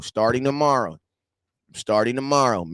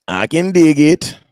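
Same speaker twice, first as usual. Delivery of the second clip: much louder, slower hype shout; 2 semitones higher, 10 dB louder than usual. Real, not acted. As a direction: belt it out to pump up the stream. Hyping himself going live.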